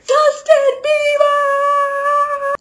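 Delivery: very high, long-held scream; bloody-murder scream. Retold or imitated. Dreamer calling a pop star for rescue, bloody-murder scream. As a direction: scream the name, stretching the last syllable out. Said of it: "I scream bloody murder".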